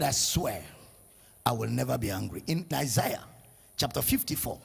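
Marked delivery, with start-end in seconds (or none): 0.0-2.4 very low, soft vow; solemn oath. Acted. God swears to the believer, solemn low oath. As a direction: sink low and quiet for the promise.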